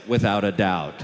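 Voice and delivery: low, loud, firm reply; emphatic certainty. Acted. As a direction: land it deep and certain. Emphatic answer to judge.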